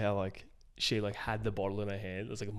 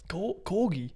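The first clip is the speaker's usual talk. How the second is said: mock dim guy; 8 semitones higher, slower than usual. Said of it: "He's like".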